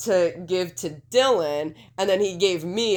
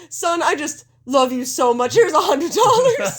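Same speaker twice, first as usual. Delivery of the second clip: South Park dad; 8 semitones higher, 6 dB louder than usual. Retold or imitated. Quoting the South Park dad's gift, high and hammed-up. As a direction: ham up the sappy TV dad handing out cash. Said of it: "where it's like".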